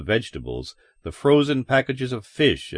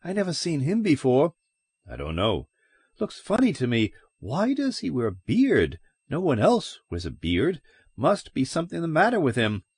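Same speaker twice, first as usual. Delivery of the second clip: higher, nosy busybody voice; suspicious gossip; 6 semitones higher than usual. A narrator's reading. Gossiping customer to grocer, higher suspicious voice. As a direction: sniff out the stranger, suspicious. Gossip suspects bearded stranger.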